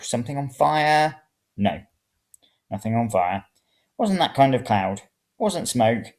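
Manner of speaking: usual speaking voice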